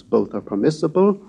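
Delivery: normal talking voice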